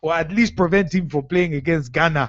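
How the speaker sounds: put-on solemn Ghanaian-accented quote. Host reading spiritualist's threat to Ronaldo, Ghanaian accent. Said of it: "That's why I'm saying it in the accent".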